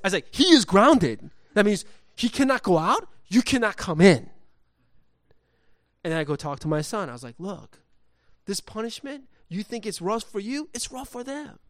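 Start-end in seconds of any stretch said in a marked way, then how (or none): 0.3-4.3 loud, high, exasperated refusal; emphatic scolding. Retold or imitated. Dad refusing son's friends at the door, emphatic. Retold firm refusal, escalating. 7.4-11.6 high-pitched pleading explanation; earnest explaining. Retold or imitated. Dad explaining punishment to his son, earnest.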